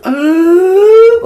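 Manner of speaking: very high, loud crying wail. Retold or imitated. Brother struck by the bat breaks into a high, loud crying wail. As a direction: wail like a hurt kid starting to cry. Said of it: "like, crying".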